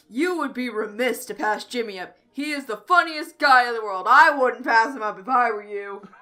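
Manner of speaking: loud, booming put-on voice; yelling in character. A narrator's reading. Wingman yelling to the dragon from under the orgy, loud put-on voice. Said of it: "I yell".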